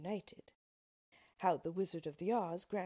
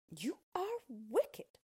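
put-on slow, high girl's accusation; drawn-out, higher-pitched protest; crying out; 6 semitones higher, much slower than usual. A narrator's reading. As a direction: cry it out as an indignant girl. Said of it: "cried Dorothy".

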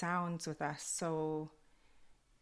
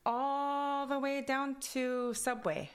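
higher, slow, drawn-out Kwakwala accent; Kwakwala accented English; 9 semitones higher, 4 dB louder, slower than usual. Shown as a demonstration. Telling friend where she's going, Kwakwala accent. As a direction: stretch it out in her Kwakwala lilt. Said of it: "my accent from Kwakwala goes into English".

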